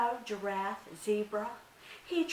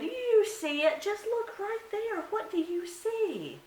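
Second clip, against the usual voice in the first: much higher, louder pushy spider; insistent Anansi; 6 dB louder than usual. Acted. Anansi pushes the deer to say it, high and insistent. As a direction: press her to look, wheedling high.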